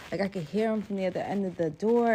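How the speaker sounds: ordinary talk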